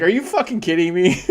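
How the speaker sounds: loud, high disbelief breaking into a laugh; incredulous laughing. Retold or imitated. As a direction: shout it then crack up laughing. Reaction to generic reply.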